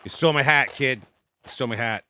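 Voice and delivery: put-on loud Boston accent, you stole my hat; a Boston tough guy calling out. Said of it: "if I were from Boston, I'd say".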